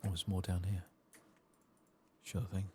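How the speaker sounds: ordinary talk, nothing marked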